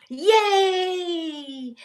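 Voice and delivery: a burst of joy; a hammed-up happy yay; happy cheer.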